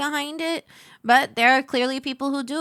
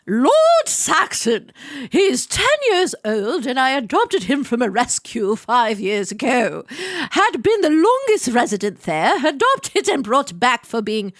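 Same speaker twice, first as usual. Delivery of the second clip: theatrical posh British period-drama narration; posh British accent. Acted. Host reading cat's adoption story, posh British accent. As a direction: read it grandly in posh British. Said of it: "read it in a posh British accent".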